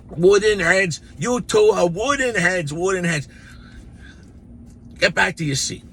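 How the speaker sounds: high, loud scolding nun; mock furious nun yell. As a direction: yell at the boys like an angry nun. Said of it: "nun scolding the boys".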